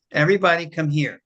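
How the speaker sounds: imitating a stern teacher's command; authoritative. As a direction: call the room to order with authority. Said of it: "very authoritative male voice".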